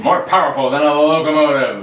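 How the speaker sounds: put-on loud, punchy old radio intro; radio announcer. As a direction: boom it like a vintage announcer. Reads radio show opening.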